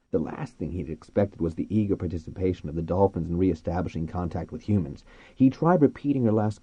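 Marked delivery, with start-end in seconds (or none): none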